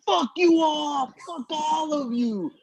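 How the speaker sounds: high, drawn-out mock yell; retold onstage cursing, stretched out for effect; defiant outburst. Comic cursing out a Giants crowd, mock defiant yell. Angry outburst at audience from stage.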